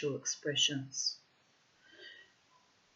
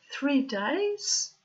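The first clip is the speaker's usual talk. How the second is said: high, louder rising question; acted disbelief; 8 semitones higher, 5 dB louder than usual. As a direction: echo it back as if shocked. Boyfriend's shocked echo.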